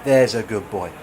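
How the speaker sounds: mock praise as to a dog; patronising. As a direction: pat him on the head verbally. Talking to self as dog.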